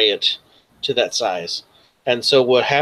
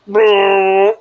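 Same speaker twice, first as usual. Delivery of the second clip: loud, high buzzing "brrr"; imitated machine whirr; 8 semitones higher, 8 dB louder than usual. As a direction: trill a sustained microwave-like motor noise.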